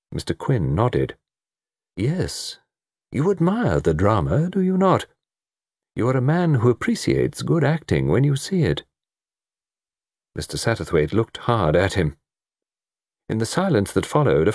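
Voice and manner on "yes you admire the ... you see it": lightly voiced, higher-pitched questioner; a hint of knowing politeness; measured observer. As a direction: smoothly draw the listener out.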